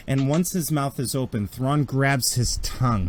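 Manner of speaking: normal talking voice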